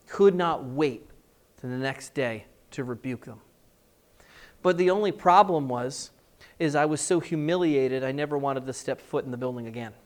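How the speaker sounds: normal talking voice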